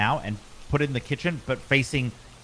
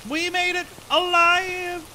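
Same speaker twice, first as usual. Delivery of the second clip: very high, slow triumphant shout; excited cheer; 5 dB louder, slower than usual. Real, not acted. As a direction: cheer with relief. Escaping the ghost house.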